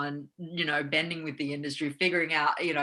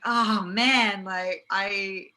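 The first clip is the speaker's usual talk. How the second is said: mock flustered actor; 3 semitones higher, 9 dB louder, much slower than usual. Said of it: "you're just like".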